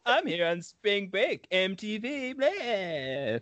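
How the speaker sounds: mock spring break guy. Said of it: "getting all of my acting out".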